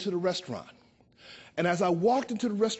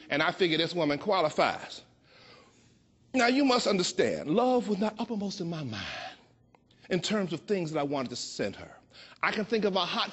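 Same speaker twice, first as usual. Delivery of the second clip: ordinary talk, nothing marked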